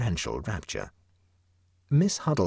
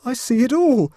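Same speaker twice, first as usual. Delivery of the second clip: very high, much louder agitated cry; shaken with agitation; 9 dB louder than usual. A narrator's reading. Huddle realising the trap, high agitated cry. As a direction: cry it out in panic. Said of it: "in a voice shaken with agitation".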